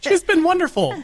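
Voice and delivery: put-on high, sweet girl voice; girly voice. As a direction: mimic a sweet young woman. Mimicking Meredith's voice.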